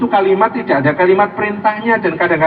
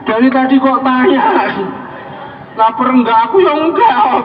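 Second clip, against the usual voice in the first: raised-pitch, complaining protest; mock exasperation; 5 semitones higher than usual. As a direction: protest high at being misunderstood. Acts out his exasperated complaint.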